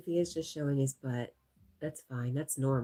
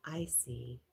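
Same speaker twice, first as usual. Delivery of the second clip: whispered aside; 3 semitones lower, 9 dB quieter than usual. Real, not acted. Aside after reading chat.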